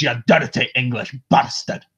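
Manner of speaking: broad Scottish accent, growled insult. Shown as a demonstration. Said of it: "for me to go to Scottish".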